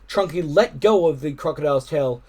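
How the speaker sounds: ordinary delivery, nothing marked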